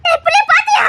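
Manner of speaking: high, urgent shout; desperate questioning; frantic demand. Acted. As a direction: demand an answer in panic. Frantic question about missing girl.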